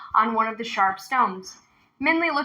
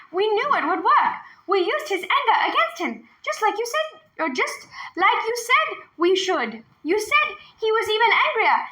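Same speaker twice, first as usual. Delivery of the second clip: put-on high, proud girl's voice; 10 semitones higher than usual. A narrator's reading. Girl telling grandfather the plan worked, high and proud. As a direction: boast to grandpa.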